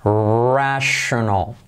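"Rational" drawn out slowly as rashonal; teacher slowly modeling English rational. Shown as a demonstration. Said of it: "Fijaos en la diferencia".

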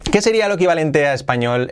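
ordinary delivery

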